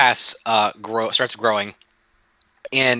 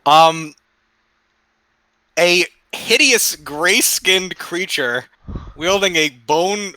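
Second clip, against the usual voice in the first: ordinary talk, nothing marked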